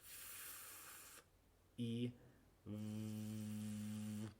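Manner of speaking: voiceless "F", then buzzing "v"; f-v drill. Shown as a demonstration. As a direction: contrast a breathy f with a voiced v. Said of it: "hacer esos dos sonidos para practicar".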